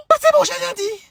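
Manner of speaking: very high, tiny backing-down voice; squeaky retreat. Acted. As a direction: shrink away in a squeak. Backing down after mother's pardon.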